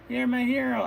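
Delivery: high-pitched swooning damsel; adoring princess. Acted. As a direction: gush at the rescuer in a high voice. Rescued princess admires hero.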